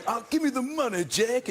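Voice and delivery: hammed-up American movie gangster demanding cash; fake American gangster accent. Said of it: "doing this pseudo-American accent".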